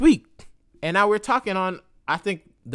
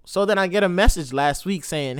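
ordinary delivery

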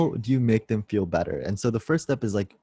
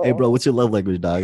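laughing mock bro; 6 dB louder than usual. Mocking blunt question.

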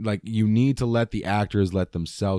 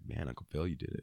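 much softer, awed murmur; hushed awe; 5 semitones lower, 14 dB quieter than usual. Retold or imitated. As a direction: breathe it out, moved. Quoting himself moved by scene.

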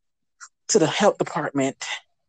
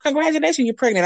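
nurse; 7 semitones higher, faster than usual. Retold or imitated. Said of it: "They were like".

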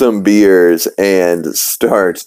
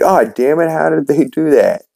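Peter fuming; 8 semitones higher than usual. Acted. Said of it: "And he's like".

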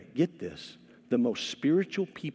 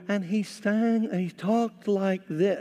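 higher, slow, floating sing-song reading; mock angelic sing-song; 5 semitones higher, slower than usual. Pastor imitating an audiobook reader's angel voice, slow and high. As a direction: lift it into a dreamy, singing angel read. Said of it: "he changes his voice".

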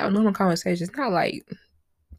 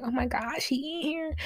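surprised; 8 semitones higher, 5 dB quieter than usual. Retold or imitated. Spotting him in the dream.

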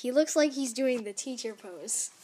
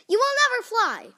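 high, loud, quick outburst; emphatic denial; 11 semitones higher, 8 dB louder, faster than usual. Acted. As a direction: rule it out loudly, pitched right up. Gerald flatly contradicts Piggy.

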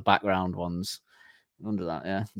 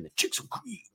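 put-on much higher and softer, a little kid singing "The chicks will cream"; six-year-old; 11 semitones higher, 8 dB quieter than usual. Shown as a demonstration. Imitating himself as a six-year-old singing to his cohost, childlike high voice. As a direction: play a small child innocently chanting "The chicks will cream". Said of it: "me as a six-year-old going".

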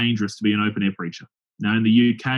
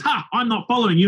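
mock defiant drunk; 10 semitones higher than usual. Drunk heckler refusing to follow.

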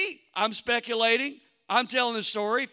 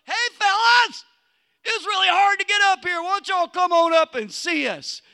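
loud, high mock-friendly call down from the rocks; calling out to enemies; 8 semitones higher, 6 dB louder than usual. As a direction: shout a cheeky invitation to the enemy below. Calling up to the Philistines.

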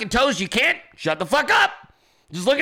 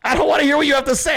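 louder fast dismissal; 5 semitones higher, 3 dB louder, much faster than usual. Shown as a demonstration. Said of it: "repeats line faster, louder".

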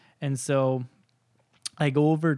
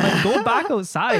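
raised, higher voice, acting out the argument; retold annoyed shout; 9 semitones higher, 6 dB louder than usual. Son ordering his dad out of the room, retold annoyed shout. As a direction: push it out louder and higher, exasperated.

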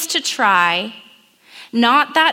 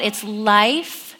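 normal talking voice

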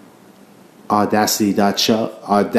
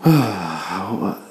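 long relaxed exhale; contented sauna sigh. Real, not acted. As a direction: let out a satisfied sauna sigh. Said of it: "that noise that I just made, that's beautiful".

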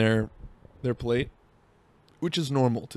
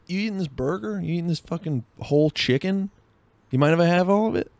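higher-pitched mock greedy diner; mock food-grabber; 6 semitones higher than usual. Imagined diner helping himself to a friend's meal, mock greedy. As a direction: play a cheeky food thief, pitched up.

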